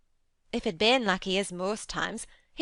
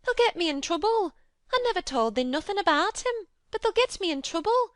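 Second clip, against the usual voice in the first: much higher, louder Yorkshire panic, near tears; scared of losing her job; half crying; 13 semitones higher, 4 dB louder than usual. A narrator's reading. Martha frightened she'll be blamed, half in tears. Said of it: "half crying".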